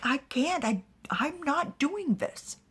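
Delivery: high, strained-for-effect acted denial; helpless protest. Accused man protests helplessly.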